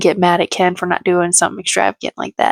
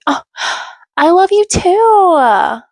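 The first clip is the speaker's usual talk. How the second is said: high, drawn-out imitation of shock; mock shocked; 10 semitones higher, 3 dB louder, much slower than usual. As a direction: gasp it out as if stunned to hear it. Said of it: "so shocked he just said it to her".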